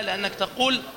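normal talking voice

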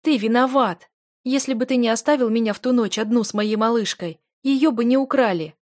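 high, loud blaming cry; furious accusation. A narrator's reading. Wife blaming her husband for the stolen daughter, furious. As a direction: hurl the guilt at him.

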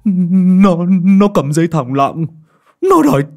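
high, loud, frantic begging; cling and beg for rescue; desperate plea. Acted. Lâm Sẹo clutching cậu Tẩy's leg begging for help, desperate plea. Terrified man begs cậu Tẩy.

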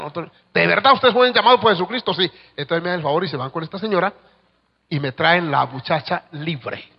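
ordinary delivery

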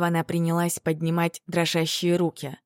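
usual speaking voice

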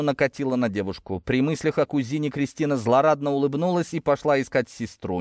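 ordinary delivery

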